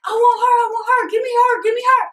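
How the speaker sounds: high, loud, fast mock yelling; boys yelling. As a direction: shout it like rowdy boys grabbing for attention. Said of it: "So they yelling".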